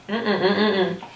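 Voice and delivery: soft mm of delight over food; savoring hum. Real, not acted. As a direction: hum happily through a mouthful. Tasting ice cream on camera.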